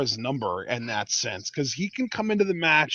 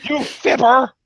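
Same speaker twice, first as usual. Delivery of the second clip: mock scolding; 6 semitones higher, 10 dB louder, slower than usual.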